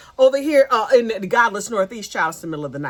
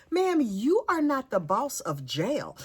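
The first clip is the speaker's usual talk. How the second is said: ordinary talk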